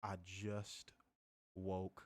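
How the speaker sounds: low, soft, slow grumbled order; just-woken low slow grumble; groggy low grumble. Retold or imitated. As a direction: grumble it half-asleep. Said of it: "And I was like".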